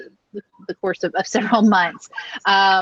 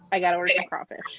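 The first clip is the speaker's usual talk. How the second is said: sneaky aside; 4 semitones lower, much faster than usual. Retold or imitated. Hiding a crawfish order from mom.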